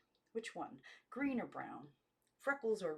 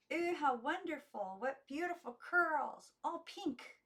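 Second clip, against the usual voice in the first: put-on much higher girlish delight, slow on the curls; awed girls; 10 semitones higher, 5 dB louder than usual. A narrator's reading. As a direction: squeal with wide-eyed admiration. Girls admire the curlers.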